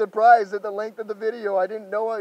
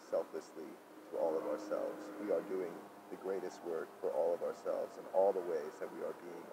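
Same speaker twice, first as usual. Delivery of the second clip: murmured spiritual channeling; channeled teaching voice; 16 dB quieter than usual. Acted. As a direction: let the message pour through you, low and hushed. Channels a soft, slow teaching.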